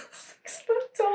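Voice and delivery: high squeaky laughing question; laughing disbelief. Real, not acted. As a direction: crack up while asking. Cracking up at hole logic.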